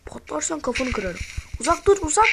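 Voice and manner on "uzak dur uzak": higher and louder yell; loud, high alarm at the mob; panicked shout. Real, not acted. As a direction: shout it to keep away. Shouts at the creeper.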